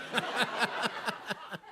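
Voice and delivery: high breathy laugh. Real, not acted. Laughs after the bit.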